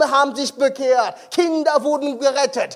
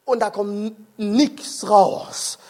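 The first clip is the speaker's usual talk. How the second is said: ordinary delivery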